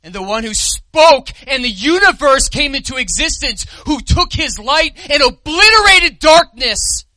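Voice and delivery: loud, high exaltation; fervent climax; preaching at full force. Acted. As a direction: swell up in praise of his power. Preacher extols Christ's power.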